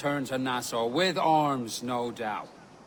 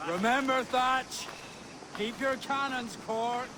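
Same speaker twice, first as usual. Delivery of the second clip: high, raised call across distance; 9 semitones higher than usual. Acted. Ben calls to the departing Thatch in a raised, high call. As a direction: call after him as he leaves.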